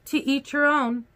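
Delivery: ordinary delivery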